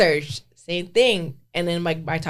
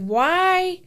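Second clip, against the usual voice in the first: exasperated why; 10 semitones higher, 4 dB louder than usual. Retold or imitated. Venting about unexplained mood swings.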